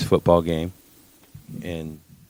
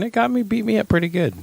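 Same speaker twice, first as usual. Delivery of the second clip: much higher, whining about sickness; high whiny voice; 13 semitones higher than usual. Acted. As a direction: pitch it up like a moan. Dramatic complaint in high voice.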